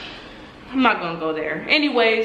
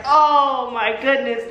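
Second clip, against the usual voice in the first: drawn-out, higher exclamation; slow, retold exasperation; dismayed groan; 4 semitones higher, slower than usual. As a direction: stretch it in mock dismay. Dismayed at mother's questioning.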